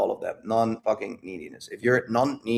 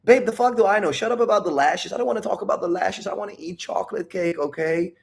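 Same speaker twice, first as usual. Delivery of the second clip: put-on higher-pitched, playful fed-up boyfriend; hammed-up protest that settles as it goes; mock exasperated; 7 semitones higher than usual. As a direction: brush off the nagging in a put-on whine. Acted retort to pestering girlfriend.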